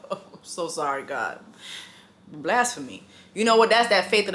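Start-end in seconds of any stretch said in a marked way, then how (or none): 0.1-3.0 hushed, much softer apology to God; a guilty half-whispered aside; sheepish apology. Real, not acted. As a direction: murmur it quickly so God hears.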